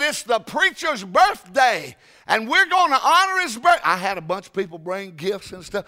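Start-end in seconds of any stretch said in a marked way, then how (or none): 0.0-3.8 high, loud, slowed proclamation; excited announcement. Retold or imitated. Speaker announces pastor's birthday party to church, loudly excited. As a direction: announce it like a grand celebration.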